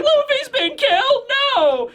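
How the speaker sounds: very high, squeaky mock wail; hammed-up tearful shriek; Chopper bawling. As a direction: cry out in cartoonish despair. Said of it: "Chopper's bawling his eyes out".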